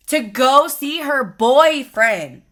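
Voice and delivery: slow, loud, incredulous punchline; outraged. Retold or imitated. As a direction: spit it out in disbelief. Angry retold text to boyfriend.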